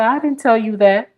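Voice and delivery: high-pitched mock denial; indignant denial. Charlie denying to Georgia, high indignant voice. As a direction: lightly voice Charlie's protest, voice way up.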